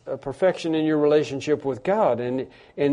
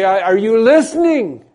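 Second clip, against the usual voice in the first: loud, slow, raised-pitch demand; shouting at God; 5 semitones higher, 7 dB louder, slower than usual. Acted. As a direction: shout it upward, demanding to be heard. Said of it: "we shout at God and we say".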